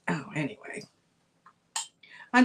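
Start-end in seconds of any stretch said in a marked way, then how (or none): 0.1-0.8 breathy whispered shrug-off; whispered aside. Real, not acted. Seller brushing off price talk to viewers, whispering. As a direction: drop to a whisper to wave it away.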